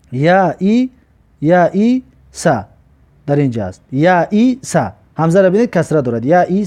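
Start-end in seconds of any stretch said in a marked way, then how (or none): 1.4-2.6 یا ای سا drawn out slowly, syllables apart; slow model reading. Shown as a demonstration. Teacher showing the hamza with kasra, slow syllables. 3.9-4.9 یا ای سا drawn out slowly, syllables apart; slow model reading. Shown as a demonstration. Teacher showing the hamza with kasra, slow syllables.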